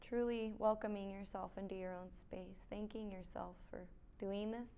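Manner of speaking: soft, unhurried yoga-teacher voice with long pauses; quiet meditation cues, spaced out; guided meditation. Real, not acted. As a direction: guide them gently into stillness.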